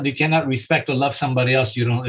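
normal talking voice

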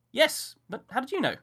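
put-on high, bright child voice; a curious little boy; small boy. A narrator's reading. Boy asks Miller how he knew, high child voice.

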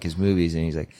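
ordinary talk, nothing marked

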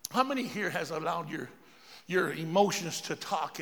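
usual speaking voice

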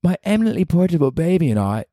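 a badly faked English mum; bad British accent. Playing a British mother with her baby, bad accent. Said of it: "you're terrible at this".

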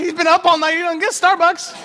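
giggling at his own joke; laughing. Real, not acted. Preacher joking to congregation about Peter, laughing.

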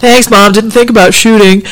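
sarcastic. Retold or imitated. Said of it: "son's sarcastic reply".

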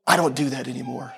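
low, softly superior refusal; ironically pious, dropping the pitch; mock self-righteous. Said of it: "no tinge of self-righteousness in my voice".

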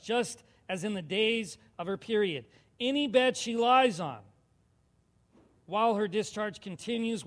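loud, measured Bible reading from the pulpit; scripture reading. Acted. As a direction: read it out strongly to the whole church. Reads scripture aloud to congregation.

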